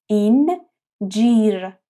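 اینجیر stretched very slowly; slow syllable demo. Shown as a demonstration. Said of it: "با دهان کشیده و باز". (اینجیر آهسته و کشیده)

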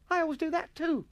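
put-on very high, loud boy voice; protesting schoolboy. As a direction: object in a piping schoolboy voice. Atkinson protests once more.

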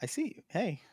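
high, soft acknowledgement; a tiny high-pitched hello, kept small; soft little greeting. Acted. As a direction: softly humour someone waving at you. Greeting the waving Marmal.

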